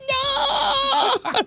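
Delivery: very high, drawn-out wailing "no"; mock terrified shriek; imitated child's scream. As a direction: cry out like a panicking child. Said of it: "Tu as crié comme si".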